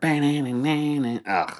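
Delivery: high whiny guitar-riff imitation, then disgusted ugh; mock a twangy guitar lick with your mouth; mimicked guitar riff. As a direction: sing out the riff, break off in disgust. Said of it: "Little guitar part".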